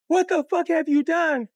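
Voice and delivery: high, loud scolding demand; outraged mother. Retold or imitated. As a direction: shout it like an appalled wife. Mother confronts father, acted.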